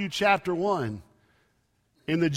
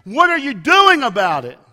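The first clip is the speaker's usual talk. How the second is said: crying out to God; 4 semitones higher, 6 dB louder than usual. Retold or imitated. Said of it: "he's crying out to God".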